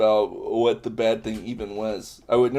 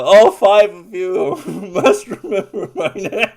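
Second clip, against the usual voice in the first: laughing plea; 5 semitones higher, 7 dB louder, slower than usual. Pretend. Dramatic plea while laughing.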